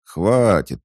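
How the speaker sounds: loud, high-pitched grumble; grumbling. A narrator's reading. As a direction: cut it short, fed up. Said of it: "проворчала вторая". (ворчливо)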